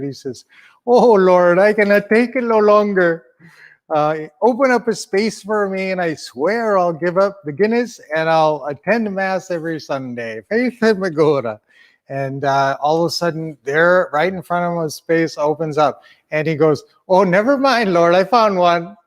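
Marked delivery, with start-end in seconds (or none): 0.9-11.6 higher-pitched, hammed-up Irish plea; frustrated Irishman. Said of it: "He's getting so frustrated". 17.1-19.0 higher, hammed-up Irish voice; Irishman in a joke. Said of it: "so there's this Irishman".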